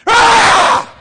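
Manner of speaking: sudden piercing high roar; startling boo. Shown as a demonstration. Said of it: "Did I scare you?".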